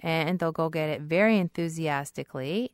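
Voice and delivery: ordinary talk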